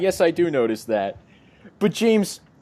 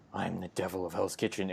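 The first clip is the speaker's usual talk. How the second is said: much lower, soft growl; gruff Daredevil voice; 10 semitones lower, 15 dB quieter than usual. Retold or imitated. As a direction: drop into a low hushed growl. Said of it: "going like".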